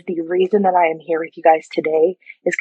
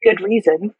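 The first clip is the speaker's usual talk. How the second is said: ordinary talk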